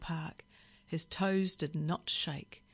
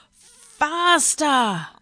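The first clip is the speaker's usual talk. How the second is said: drawn-out high, loud screech; parrot scream; 10 semitones higher, 11 dB louder than usual. A narrator's reading. Parrot screaming in the driver's ear. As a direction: scream it right in his ear. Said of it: "it screamed in his ear".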